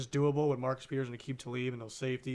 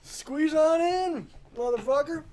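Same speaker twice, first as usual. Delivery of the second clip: loud drawn-out call; 5 dB louder, slower than usual. Acted. Hosts cramming in for shot.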